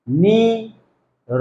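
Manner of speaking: slow split নি, র with the r vowel held open; syllable by syllable. Shown as a demonstration. Teacher sounding out নি র for students. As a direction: break নি and র apart with an o after র. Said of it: "এই যে রয়ের সঙ্গে অ উচ্চারণ করলাম".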